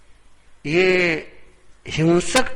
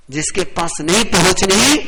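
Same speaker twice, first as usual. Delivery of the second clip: louder, rising swell to the end; speaking loudly; 3 semitones higher, 7 dB louder than usual. Shown as a demonstration. Preacher showing where a loud voice reaches, speaking loudly. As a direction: raise the voice so it carries too far. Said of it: "और जोर से बोलेंगे".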